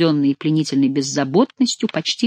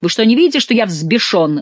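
angry outburst starting high and loud; enraged roar; 5 semitones higher, 4 dB louder than usual. A narrator's reading. Monsoreau confronts Saint-Luc, understated enraged roar. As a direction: hit the opening hard, then drag out the fury. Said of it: "взревел Монсоро".

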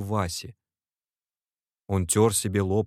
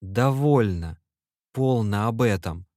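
usual speaking voice

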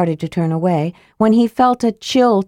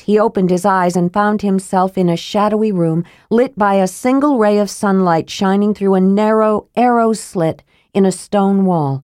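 plain reading voice

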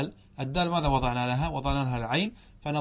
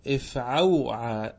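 slow syllable-by-syllable pattern; 3 semitones lower, much slower than usual. Shown as a demonstration. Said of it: "كيف نزن اغدودن؟".

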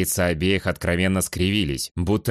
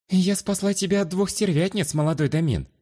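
high, humble lowered voice; meek; 11 semitones higher than usual. Acted. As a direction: answer meekly. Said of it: "смиренно ответила женщина".

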